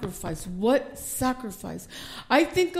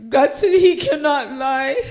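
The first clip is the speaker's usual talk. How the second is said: louder, voice breaking into a cry; tearful declaration; 2 semitones higher, 7 dB louder than usual. Real, not acted. Pastor moved to tears before the congregation, crying declaration.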